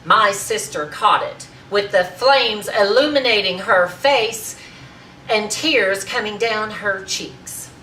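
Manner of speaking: loud, high and grandly emphatic; ringing, dramatic climax; triumphant declamation. Acted. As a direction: proclaim your sister's glory, stretching the final words. Triumphant climax of the acted tirade.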